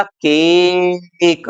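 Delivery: "केक" with the A drawn out long; long A demo. Shown as a demonstration. Teacher shows students the A sound, "केक" drawn out. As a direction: stretch the vowel in "केक" slowly. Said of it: "यहाँ पर हम ए को ए बोलेंगे".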